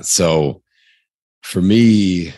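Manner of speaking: ordinary delivery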